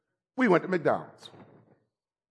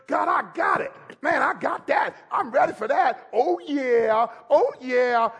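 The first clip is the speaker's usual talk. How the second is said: excited churchgoer; 5 semitones higher than usual. Retold or imitated. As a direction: shout it like you just got blessed. Churchgoer fired up after service.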